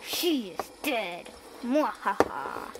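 low slow evil gloat; villain gloating. Acted. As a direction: gloat slowly, then cackle. Villain gloats over victim.

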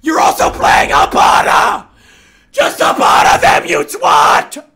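very loud, slow yell; furious shout. Real, not acted. Scolding his teammate, he yells very loud and slow. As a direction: blast it at him.

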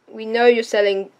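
normal talking voice

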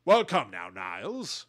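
put-on posh, affected upper-class accent; Frasier. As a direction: put on a cultivated upper-class accent, starting loud and dropping off. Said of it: "the character Frasier has this".

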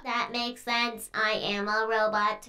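low, soft mock robot; mimicked robot voice. [name] joins in as a robot, low put-on robot voice. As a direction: play along in a quiet machine voice. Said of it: "stop talking like that".

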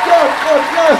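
high, loud cheering yes; excited yelling. Real, not acted. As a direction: cheer it out with joy. Reacting to a stunning performance.

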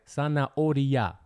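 Zanahoria drawn out syllable by syllable; slowed-down teaching of Zanahoria; slow enunciation. Shown as a demonstration. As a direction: say Zanahoria slowly, stretching each syllable for a learner. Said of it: "This one's kinda hard".

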